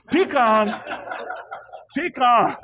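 "Pecan" as pee-kahn, high and loud; pee-KAHN pronunciation. Shown as a demonstration. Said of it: "we say pecan".

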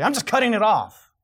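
high, loud burst of frustration; sudden hammed-up outburst; mock exasperation. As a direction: snap as if losing it over bad hair. Acts out losing it over hair.

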